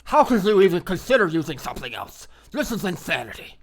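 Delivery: hammed-up disbelief from a hardcore coder; mock hardcore programmer. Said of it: "the super hardcore programmers".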